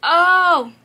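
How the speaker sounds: loud, high startled "oh"; sudden realisation. Real, not acted. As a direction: burst out as if just remembering. Suddenly remembers forgotten money.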